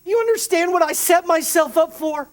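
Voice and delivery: ordinary talk, nothing marked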